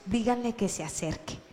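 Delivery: hushed, gentle instruction; said to those nearby. Acted. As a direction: say it softly to those closest. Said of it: "le dijo a sus más cercanos".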